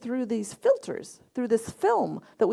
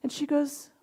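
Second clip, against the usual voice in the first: ordinary talk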